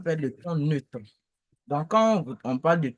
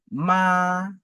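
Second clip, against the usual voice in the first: "Ma" held long, high and level; louder, sustained high note on "Ma"; first tone; 3 semitones higher, 4 dB louder than usual. Shown as a demonstration. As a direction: keep "Ma" high and even, no movement. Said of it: "le premier ton".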